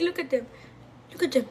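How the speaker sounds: usual speaking voice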